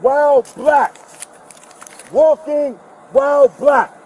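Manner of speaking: high, slow, chant-like holler; real defiant outcry, words stretched; protest cry. Real, not acted. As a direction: call it out slowly like a slogan. Handcuffed, calling out his grievance.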